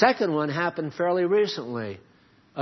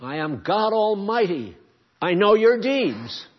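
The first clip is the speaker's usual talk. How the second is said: high, loud, thundering voice of God; booming voice of God; God Almighty voice; 6 semitones higher, 7 dB louder than usual. Acted. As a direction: proclaim it as the Almighty demanding attention. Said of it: "he's saying".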